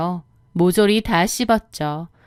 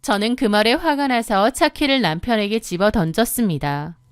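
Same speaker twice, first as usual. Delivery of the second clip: ordinary delivery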